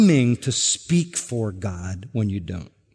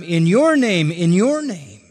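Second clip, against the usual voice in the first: "your" punched higher and louder, falling; stress on YOUR; 3 semitones higher, 4 dB louder than usual. Shown as a demonstration. Preacher showing the congregation the emphatic "your", stressed. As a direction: hit the word "your" in "In your name". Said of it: "the you is emphatic each time".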